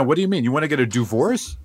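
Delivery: ordinary talk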